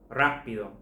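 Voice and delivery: rápido with the beat on RÁ, the last two syllables light; shifted stress. Shown as a demonstration. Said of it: "also have the shift in stress".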